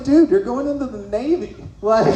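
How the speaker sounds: amused, disbelieving retort; mock incredulous. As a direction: point out the obvious, half laughing. Coach scoffs at crying student.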